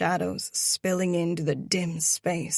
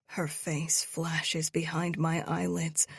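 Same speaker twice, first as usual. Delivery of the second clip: usual speaking voice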